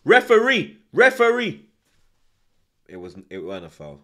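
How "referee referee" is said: outraged cry of referee; shouting for the referee. Real, not acted. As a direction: yell at the ref through the screen. Appealing to the ref on TV.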